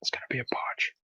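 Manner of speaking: unvoiced-sounding hushed whisper; soft, secretive prediction; whispered aside. Real, not acted. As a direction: whisper it under your breath. Aside before a failed roll.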